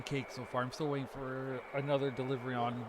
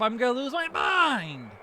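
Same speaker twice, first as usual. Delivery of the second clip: higher, louder exasperated yell; frustrated shout; 8 semitones higher, 6 dB louder than usual. Real, not acted. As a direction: yell it out, fed up. Frustrated by long wait.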